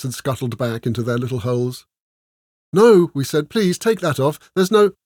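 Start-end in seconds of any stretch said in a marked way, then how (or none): 2.7-3.1 high, loud cry of "no"; pleading outburst; dismayed protest. A narrator's reading. Dismayed listeners plead with her. 3.5-4.9 high-pitched, urgent plea; pleading protest; dismayed pleading. A narrator's reading. Dismayed listeners plead, get interrupted.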